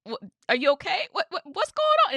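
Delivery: mock alarmed asking, pitch jumping up; worried friend. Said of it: "'cause it was like".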